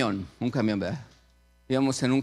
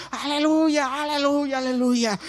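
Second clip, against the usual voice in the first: shouted hallelujah; 10 semitones higher, 4 dB louder than usual. Retold or imitated. Companion bursts down the aisle in a sketch, shouting. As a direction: burst in hollering praise. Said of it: "entra otro gritando por allá".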